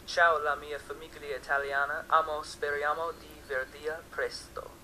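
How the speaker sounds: careful, halting Italian greeting; halting phrasebook Italian. Shown as a demonstration. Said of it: "excuse my, my bad Italian here".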